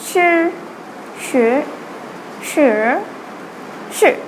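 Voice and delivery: sure said level, then rising, then dipping, then falling; four tones in a row. Shown as a demonstration. Teacher recapping the four tones on sure. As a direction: run sure through all four tones. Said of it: "So we've got".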